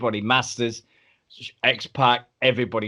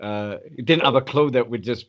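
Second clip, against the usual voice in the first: ordinary talk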